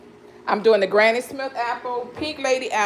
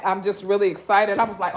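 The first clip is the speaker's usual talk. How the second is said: normal talking voice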